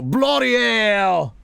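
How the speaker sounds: put-on Australian accent. Mocking how an Australian would react to a kangaroo, hammed-up Australian accent. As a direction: ham up a startled Australian bloke. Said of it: "say with an accent".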